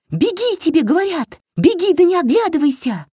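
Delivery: very high, urgent screaming; frantic high-pitched yelling; shrieking. A narrator's reading. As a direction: scream at her to flee. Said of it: "завопила Васька".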